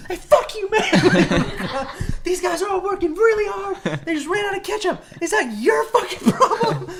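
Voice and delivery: high, loud screaming rant, laughing; hammed-up public meltdown; freaking out. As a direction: yell wildly in comic outrage. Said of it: "freak out".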